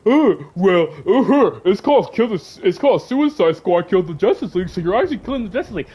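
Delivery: exaggerated mocking smartass impression. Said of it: "smartasses who are gonna be like".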